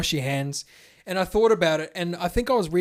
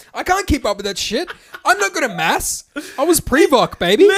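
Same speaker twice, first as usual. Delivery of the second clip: loud; 10 semitones higher, 8 dB louder than usual. Real, not acted. Self-mocking loud boast.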